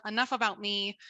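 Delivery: usual speaking voice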